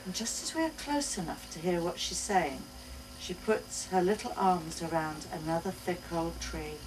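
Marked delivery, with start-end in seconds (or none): none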